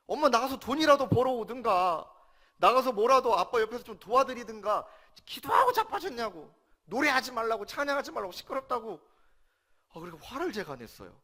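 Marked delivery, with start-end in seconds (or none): 0.1-4.8 higher and louder, scolding mother; half-acted outburst at mother; retold angry yelling. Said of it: "어머님한테 소리 질렀습니다". 5.2-6.5 "기도하고 자빠졌냐고" yelled much higher and louder, "기도하고" hit hard; a re-enacted teenage scream at mother; retold furious yell. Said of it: "어머님한테 소리 질렀습니다". 6.8-9.0 half-acted outburst dying away; retold angry yelling. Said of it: "어머님한테 소리 질렀습니다".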